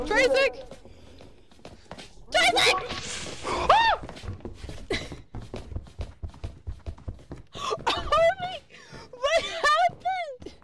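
calling out. Real, not acted. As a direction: yell for friends in panic. Calling friends in fright.

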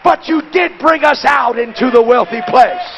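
high, loud preacher's cadence; full-force preaching. Acted. Preacher building the congregation up, full-force preaching. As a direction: preach it out to the crowd.

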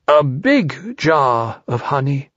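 slow, drawn-out bear voice, insisting; insistent. A narrator's reading. Pooh pressing for a bigger bait, slowly insistent. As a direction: stretch each word to insist. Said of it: "Pooh insisted".

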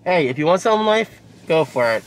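normal talking voice

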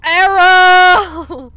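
loud, high warning cry; alarmed shout. Acted. Warning friends of incoming arrow.

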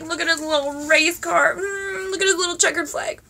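high-pitched delighted cooing; cute-toy cooing. Real, not acted. Cooing over a tiny toy.

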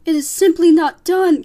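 high, very loud scandalised aunt; Aunt Florence. Acted. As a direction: proclaim it as an outraged aunt. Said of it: "came the distinctive voice of Despereaux's Aunt Florence".

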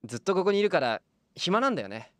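childlike patient, lightly voiced; a lonely boy asking to chat.

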